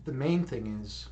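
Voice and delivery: guided meditation. Acted. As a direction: ease the listeners into calm, unhurried and soft. Leads a meditation.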